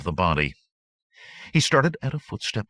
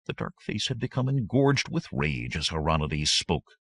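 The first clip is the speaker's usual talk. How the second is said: plain reading voice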